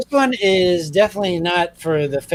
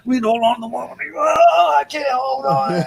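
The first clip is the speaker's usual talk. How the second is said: mock straining; 7 semitones higher than usual. Pretending to be pulled away.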